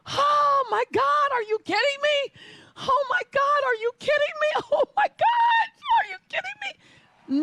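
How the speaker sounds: shocked joy. Retold or imitated. Hearing she broke the record.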